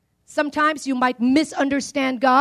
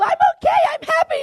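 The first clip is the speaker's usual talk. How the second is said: much higher, sing-song mock cheer; fake cheerful; faster than usual. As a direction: pretend everything is fine, brightly and falsely. Mocking a fake cheerful answer.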